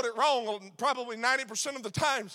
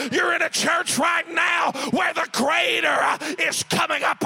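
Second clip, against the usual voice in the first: loud, rising preacher's shout, slowing on the last words; stretched-out shouted climax on abundantly; full-force preaching; 2 semitones higher, 7 dB louder than usual. Acted. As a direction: build the congregation up to a roar.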